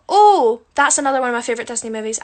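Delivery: usual speaking voice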